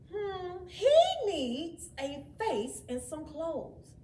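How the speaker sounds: a hint of musing, slow; ponder, then decide; thoughtful child. A narrator's reading. Jenny pondering what the snowman needs, lightly thoughtful. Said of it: "Then Jenny said".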